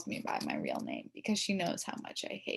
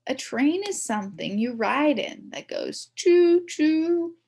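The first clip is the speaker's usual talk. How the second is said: smug correction; 8 semitones higher, 7 dB louder, slower than usual. Acted. Boy correcting girl smugly.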